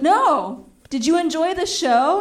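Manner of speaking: high, loud chipper question; breezy cheerful deflection; mock cheerful. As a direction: act unbothered and sunny. Cheery reply to threat.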